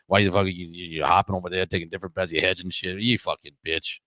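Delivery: put-on low, gruff Boston accent, picking a fight; a Southie tough guy mocking him in a Boston accent. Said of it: "we're just doing Boston, Boston accents".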